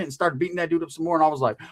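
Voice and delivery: ordinary talk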